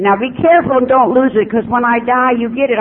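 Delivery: high, loud mock mother warning; a hint of the mother, pitched high and loud; mimicking mother. Mother voiced in story.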